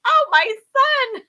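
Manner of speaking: high, loud, slow wail; hammed-up motherly distress; mock anguish. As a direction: wail it out, drawing out each word. Mock wail at baby's first injection.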